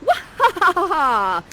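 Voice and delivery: slow, loud, high villain laugh; evil cackle. Shown as a demonstration. Prospera after the magic works, with a loud slow evil cackle. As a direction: cackle like a wicked sorceress. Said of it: "you give us an evil cackle".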